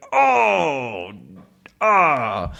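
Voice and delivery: high pained oh-ah after stubbing a toe; mock pain cry. Teacher imitates stubbing a toe, a mock pain cry. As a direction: let out a hammed-up ouch. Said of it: "which is like".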